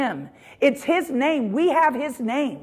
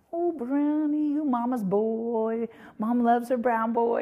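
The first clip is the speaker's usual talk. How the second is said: doting pet talk; 7 dB quieter than usual. Shown as a demonstration. Owner fussing over her dog, doting coo. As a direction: coo sweetly, drawing the words out. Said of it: "I can just talk to him".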